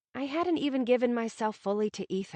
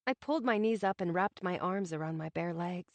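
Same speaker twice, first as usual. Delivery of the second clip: plain reading voice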